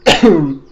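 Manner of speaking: a sudden, loud choking cough; loud real cough; real coughing fit. Real, not acted. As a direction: cough it out like water went down the wrong pipe. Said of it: "it went through the wrong pipe".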